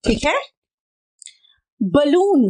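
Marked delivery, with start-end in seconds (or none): 1.8-2.5 बलून said loudly with a short ba; loud model word; teaching pronunciation. Shown as a demonstration. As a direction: call out बलून clearly as ba-loon. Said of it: "ब का sound आ रहा है".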